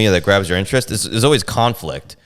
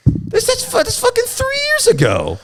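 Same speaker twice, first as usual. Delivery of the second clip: squeaky outraged disbelief. Retold or imitated. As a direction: voice jumps way up in outrage. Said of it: "I'm like".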